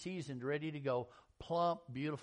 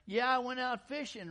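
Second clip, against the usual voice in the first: high, louder bragging fisherman voice; mock fisherman brag; 9 semitones higher, 7 dB louder than usual. Preacher mocking fishermen's tall tales, high and bragging. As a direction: play up the fish tale, high.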